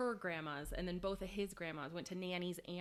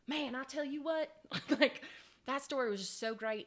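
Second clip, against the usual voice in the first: put-on Southern accent; 7 semitones higher, 6 dB louder than usual. Roommate's boyfriend's dad recapping the story, Southern accent. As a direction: excitedly retell it as a Southern dad. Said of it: "that was a very good Southern accent".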